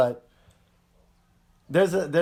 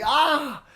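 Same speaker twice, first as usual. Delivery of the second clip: pained yelp; 11 semitones higher, 5 dB louder than usual. Retold or imitated. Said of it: "And he's like".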